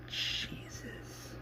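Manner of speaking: breathless stunned whisper. Retold or imitated. Rick hearing about walkers in the barn, stunned whisper. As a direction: breathe it out under your breath.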